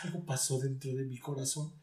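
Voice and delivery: normal talking voice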